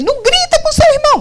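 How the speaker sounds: high, fast mock yell; parent's hammed-up scolding, pitch way up. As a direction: yell at the child while telling them off. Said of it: "mas eu tô gritando com ele".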